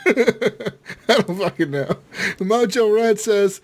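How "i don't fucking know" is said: said through laughter, slow and higher; laughing. Real, not acted. Host laughs while admitting he doesn't know. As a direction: giggles the words out.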